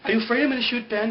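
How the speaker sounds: a mock irritated Sun telling Jack to shut up; annoyed Sun. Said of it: "she's like".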